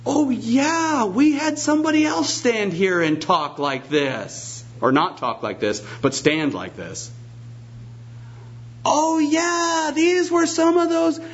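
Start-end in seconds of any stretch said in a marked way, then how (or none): 0.1-4.6 high, far-off cry of recognition, then settling; sudden realization. Retold or imitated. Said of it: "it's like lights dawning". 8.8-11.2 sudden realization. Retold or imitated. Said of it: "it's like lights dawning".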